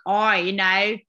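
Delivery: imitating a hint of Australian accent. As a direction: lightly mimic her own Aussie twang. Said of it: "I had a little bit of a Australian accent, like".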